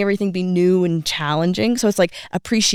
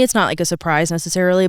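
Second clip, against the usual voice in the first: ordinary talk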